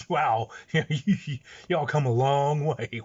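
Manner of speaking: Southern drawl. Retold or imitated. Voicing the gangbangers' amazement.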